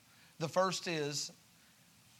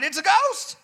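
high, loud fearful shout; terrified cry; 13 semitones higher, 9 dB louder than usual. Acted. Disciples on the boat screaming in fear, high and loud. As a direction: cry out like you've seen a ghost.

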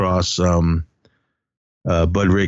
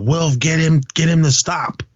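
higher-pitched imitated plea; retold urgent appeal; 8 semitones higher than usual. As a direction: act out appealing for someone to step in. Peter appeals to Wilf to intervene.

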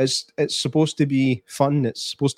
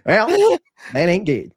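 a much higher yelped well, then a put-on American accent; shouted mock American accent; 6 semitones higher, slower than usual. Copying the co-host's joke, a yelped mock American accent.

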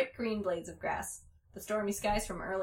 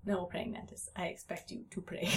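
hushed bug voice; 3 semitones lower, 7 dB quieter than usual. Acted.

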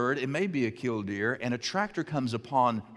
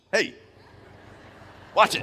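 bird telling off the farmer; 6 semitones higher than usual. Retold or imitated. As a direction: snap at the tractor like an offended bird.

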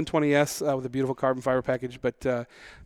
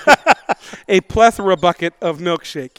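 loud, raised voice on "plethora bucket"; emphatic exaggeration; 3 semitones higher, 9 dB louder, slower than usual. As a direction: boom out the inflated description. Loud comic echo of the exaggeration.

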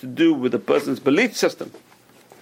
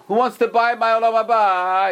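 imitated tipsy merchant's announcement; announcing an offer; 8 semitones higher than usual. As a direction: call it out to the whole room. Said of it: "He starts announcing".

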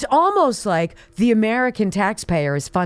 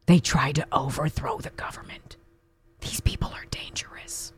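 hushed conspiratorial whisper; 6 semitones lower, 8 dB quieter than usual. Pretend. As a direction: whisper it like a secret. Mock secretive warning.

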